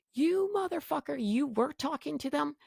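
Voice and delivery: high-pitched indignant accusation without shouting; re-enacted incredulous anger, pitch way up; retold outrage. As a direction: squeal it in mock betrayal. Retold outrage at husband's betrayal.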